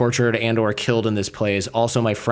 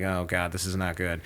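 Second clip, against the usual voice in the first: low, much softer aside to self; quiet dread; 5 semitones lower, 10 dB quieter than usual. Retold or imitated. Captive taking in the basement, quiet dread. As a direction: sink into a hushed uh-oh.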